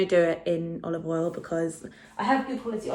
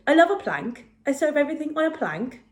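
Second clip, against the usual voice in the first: put-on higher, singsong Welsh accent; more confident mock Welsh accent, pitched up; Welsh accent impression; 7 semitones higher than usual. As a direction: imitate a Welsh woman with a lifted lilt. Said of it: "Oh yeah, that was good".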